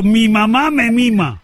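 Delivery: slow, syllable by syllable, laughing through it; teasing slow-motion tongue twister; slow example phrase. Shown as a demonstration. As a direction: spell it out slowly with a chuckle. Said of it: "Intenta algo facilito".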